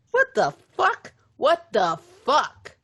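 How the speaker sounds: high, slow stunned what the fuck; drawn-out shocked exclamation; gasping disbelief at the news. Retold or imitated. Shocked reaction to niece story.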